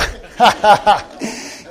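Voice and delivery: bursting into delighted laughter; a real laugh at the answer. Real, not acted. Preacher laughing at strangers picking his wife. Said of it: "I just laugh and clap".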